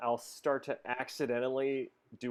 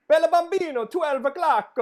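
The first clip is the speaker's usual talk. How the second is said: hammed-up Italian accent, much higher and louder; Nicolas Cage Italian accent; 13 semitones higher, 10 dB louder than usual. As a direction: belt it out like a cheesy movie Italian. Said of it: "Nicolas Cage goes".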